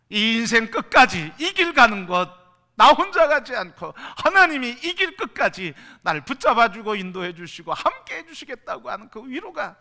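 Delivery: loud, high exultant preaching; preaching at full force. Acted. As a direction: proclaim the comfort to everyone.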